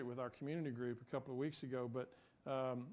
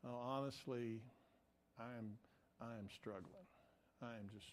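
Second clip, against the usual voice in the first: much softer, lower, halting admission; imitating tearful confession; 5 semitones lower, 11 dB quieter than usual. Woman confiding in a stranger through tears, subdued and sad. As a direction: imitate someone quietly admitting they struggle. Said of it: "she just started crying".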